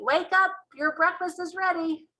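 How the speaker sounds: high, sing-song mother calling; mummy's voice. Acted. Mother waking her child for breakfast, high calling voice. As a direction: call him gently but brightly awake. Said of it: "my mummy's voice saying".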